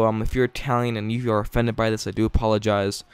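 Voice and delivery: ordinary talk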